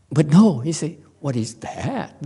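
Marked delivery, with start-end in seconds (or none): 1.2-2.1 much softer, composed question; elegant quiet ask. Retold or imitated. Master calmly deflecting, soft and elegant. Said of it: "He's so elegant".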